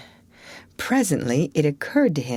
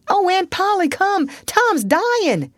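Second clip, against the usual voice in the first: high, loud cry for help; panicked call; 13 semitones higher, 3 dB louder than usual. Acted. Sid calling Aunt Polly downstairs, panicked shout.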